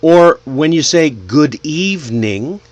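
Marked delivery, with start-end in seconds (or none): 1.1-2.6 "good evening" slow, each word full; unlinked. Shown as a demonstration. Teacher shows form before linking, slow and separate. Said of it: "let it flow".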